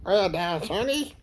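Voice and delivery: a hint of cartoon Granny. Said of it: "he did the Wicked Witch and Granny".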